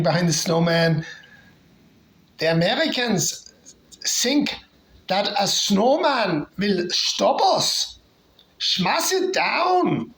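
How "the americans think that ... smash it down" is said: hammed-up German soldier accent, higher and slowed. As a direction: play the enemy soldier in a thick German accent. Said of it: "Sorry about the German accent".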